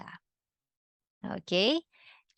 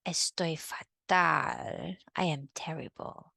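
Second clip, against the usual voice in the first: feeling terrible; 3 semitones lower, 4 dB quieter than usual. Shown as a demonstration. Teacher acts feeling terrible.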